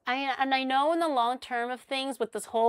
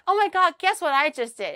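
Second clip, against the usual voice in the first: high, louder mock-proud announcement; proud excitement; 6 semitones higher, 6 dB louder than usual. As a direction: imitate him bursting with pride. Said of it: "he was really proud of himself".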